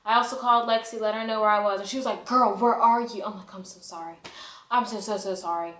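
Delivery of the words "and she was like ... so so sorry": usual speaking voice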